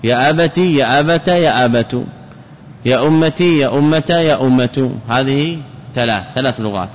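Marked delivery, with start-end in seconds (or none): 0.0-2.1 يا أبت run through as abati, abata, abatu; change only the last vowel of أبت: i, a, then u; three vowels on ta. Shown as a demonstration. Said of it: "تثليث التاء". 2.9-5.0 يا أمة run through as ummati, ummata, ummatu; change only the last vowel of أمة: i, a, then u; three vowels on ta. Shown as a demonstration. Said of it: "تثليث التاء".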